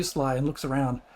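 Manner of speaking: ordinary talk, nothing marked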